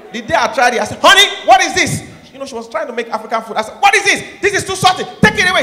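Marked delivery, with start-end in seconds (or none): 1.0-2.1 rising to a high loud yell; mock angry husband. Husband rejecting salty food from wife, mock angry yell. 3.8-5.6 rising to a high loud yell; mock angry husband. Husband rejecting salty food from wife, mock angry yell.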